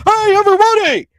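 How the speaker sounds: very high, loud, over-the-top announcer impersonation; Tony Khan. Acted. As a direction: squeal out a hammed-up, hyped introduction. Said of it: "in my best Tony Khan impersonation".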